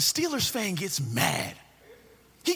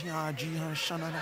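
mock speaking in tongues; 4 semitones lower, 8 dB quieter than usual. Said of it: "speaking in tongues".

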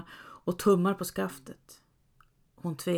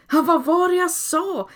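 loud, much higher proud shout; calling out; 10 semitones higher, 7 dB louder than usual. A narrator's reading. Father proud of his clever daughter, loud triumphant call. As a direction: crow it out triumphantly. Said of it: "ropade han".

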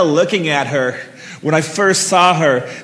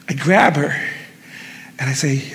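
ordinary delivery, nothing marked

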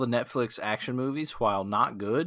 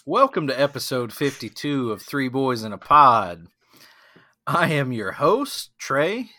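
louder, upbeat show-host opening; podcast intro; 2 semitones higher, 5 dB louder than usual. Real, not acted. Host welcoming listeners to the podcast, upbeat. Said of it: "podcast host intro".

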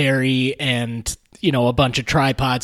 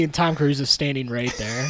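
normal talking voice

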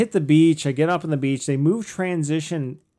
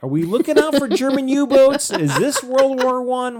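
higher, louder, sarcastic questions; mock the kayaker weaving all over the lake; incredulous rant that slows at the end; exasperated mockery; 10 semitones higher, 7 dB louder than usual. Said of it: "I'm like".

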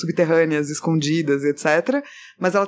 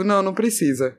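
normal talking voice

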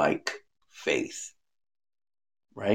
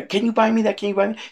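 put-on high, begging little kid voice, much higher; pleading child; much faster than usual. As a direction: beg like a four-year-old at Christmas. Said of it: "when they say".

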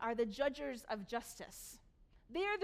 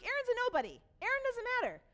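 exasperated; 10 semitones higher, 5 dB louder, faster than usual. Retold or imitated. Moses dismisses Korah's complaint.

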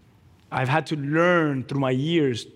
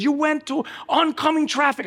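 higher-pitched, urgent yelled accusation; alarmed examiner; 12 semitones higher than usual. Retold or imitated. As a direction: cry out in alarm at the driver. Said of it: "He goes".

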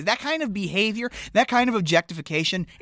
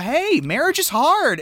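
Barb; 8 semitones higher, slower than usual. Retold or imitated. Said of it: "when Barb says the thing about".

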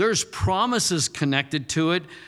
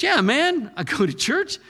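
put-on drunk owner; 8 semitones higher than usual. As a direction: boast about church. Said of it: "He goes".

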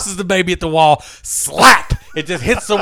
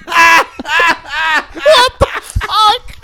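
high, cracking laughter; laughing disbelief; 11 semitones higher, 4 dB louder, much slower than usual. Real, not acted. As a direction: break up laughing at the punchline. Punchline reaction.

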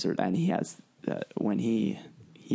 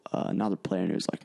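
ordinary delivery

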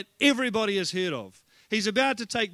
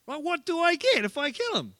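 high-pitched, cheeky David voice; eager young challenger; 7 semitones higher than usual. Acted. Preacher playing David asking soldiers about the reward, high cheeky voice. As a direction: pipe up high, angling for the prize.

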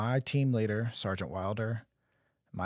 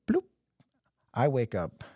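usual speaking voice

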